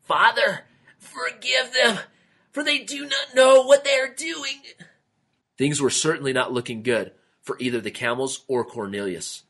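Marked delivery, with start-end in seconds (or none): 0.1-4.6 high, strained gasping prayer; gasped plea. A narrator's reading. Camel gasps a prayer while squeezed, strained high plea. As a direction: plead for mercy while being squeezed. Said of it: "Then Justus gasped out".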